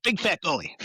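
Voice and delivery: shouted answer. Retold or imitated.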